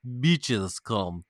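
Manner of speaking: drawn-out mock Russian accent. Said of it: "with a Russian accent".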